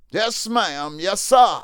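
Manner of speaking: normal talking voice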